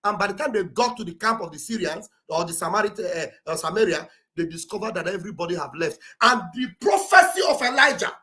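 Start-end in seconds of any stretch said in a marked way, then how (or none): none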